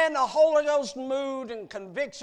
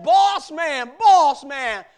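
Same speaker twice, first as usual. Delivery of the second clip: much higher and louder calling out; hollering after him; 10 semitones higher, 15 dB louder than usual. Acted. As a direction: holler across the field after the boss man. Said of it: "he hauled".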